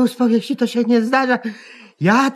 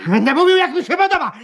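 defiant outburst; 6 semitones higher, much faster than usual. Acted. Defiant man refuses to hush.

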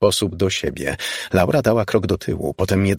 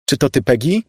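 higher, louder frightened call; 7 semitones higher, 4 dB louder than usual. Acted. Laura calls out to the unseen voice, louder. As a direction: call out into the empty room. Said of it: "zawołała".

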